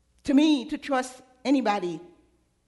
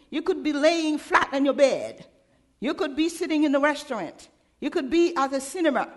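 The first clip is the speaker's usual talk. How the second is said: usual speaking voice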